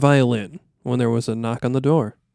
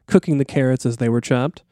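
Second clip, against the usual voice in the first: normal talking voice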